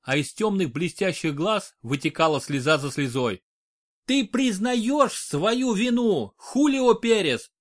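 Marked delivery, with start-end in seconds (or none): 4.1-7.5 slow, high, forceful accusing shout. A narrator's reading. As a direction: hurl the accusation at him. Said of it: "крикнул Хайме".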